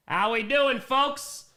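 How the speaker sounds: high, loud welcome to viewers; hyped greeting. Real, not acted. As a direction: call out to the crowd. Greeting the stream audience.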